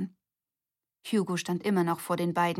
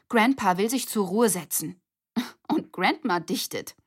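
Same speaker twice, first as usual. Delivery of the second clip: a laughing, louder report; half giggling at the news; incredulous with a laugh; amused disbelief; 3 semitones higher, 5 dB louder than usual. Acted. Girls report odd news laughing.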